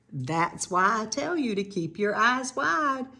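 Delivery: usual speaking voice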